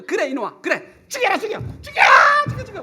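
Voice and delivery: put-on an old woman daring him, rising to a high shout; grandma yelling. Grandmother daring her grandson to hit her, shouting. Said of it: "그러면서 할머니가".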